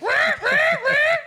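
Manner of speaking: cat noise. Shown as a demonstration. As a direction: screech like a cat. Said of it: "What noise does a cat make?".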